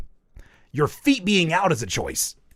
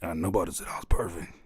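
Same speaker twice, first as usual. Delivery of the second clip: low, softer imitation; deep mocking voice; 9 semitones lower, 5 dB quieter than usual. Mimicking friend's denial, deep mocking voice. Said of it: "That's how you sound".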